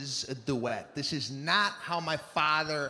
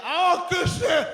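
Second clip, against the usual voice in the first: mock shout; 13 semitones higher, 7 dB louder than usual. Father shouting at the mother on his way to work, loud. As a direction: yell it out, fed up and leaving.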